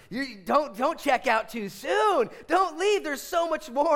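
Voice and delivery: high shouted urging; impassioned, high, loud exhortation; urgent plea. Retold or imitated. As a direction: beg them at full volume not to go. Urges son and congregation to stay.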